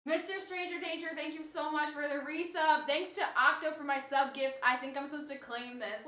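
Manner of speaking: ordinary talk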